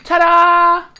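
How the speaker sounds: loud, high triumphant ta-da; excited reveal. Real, not acted. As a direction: announce it proudly. Showing blended sauce.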